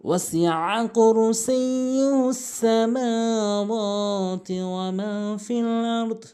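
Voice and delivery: slow chanted tajweed recitation; Quran recitation. Acted. Teacher recites the verse for students, slow melodic chant.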